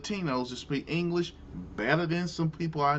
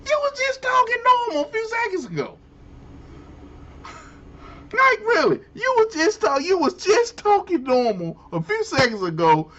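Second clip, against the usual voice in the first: exasperated disbelief; faster than usual. Real, not acted. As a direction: call him out in disbelief. Incredulous rant at accent switch.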